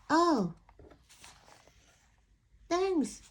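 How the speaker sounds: small, polite little duckling. Acted. Duckling politely asks the pigeon, lightly voiced.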